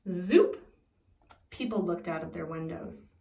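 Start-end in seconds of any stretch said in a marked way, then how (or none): 0.1-0.6 loud rising zoop; loud magical whoosh word; magic zoop sound. Acted. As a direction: zap it like a magic wand.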